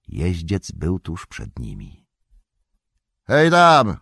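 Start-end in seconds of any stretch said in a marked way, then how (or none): 3.3-4.0 loud, high call into the fog; shout. Acted. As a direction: yell out a sharp warning. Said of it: "krzyknął Magot". (głośny okrzyk w mgłę)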